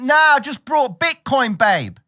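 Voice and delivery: high, loud careless brush-off; dismissive trader. Retold or imitated. Trader brushing off his partner, loud and high mock voice. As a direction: wave her off, too excited to care.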